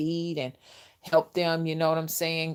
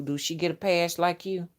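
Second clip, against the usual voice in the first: ordinary talk, nothing marked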